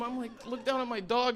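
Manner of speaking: usual speaking voice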